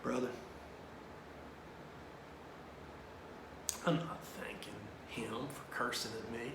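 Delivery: low, soft, measured priest; quiet, sincere retold counsel; Father Tim. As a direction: explain gently with long pauses. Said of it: "he looks at me and he goes".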